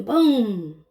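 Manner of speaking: plain reading voice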